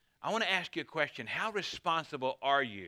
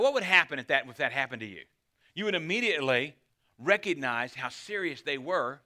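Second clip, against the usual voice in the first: usual speaking voice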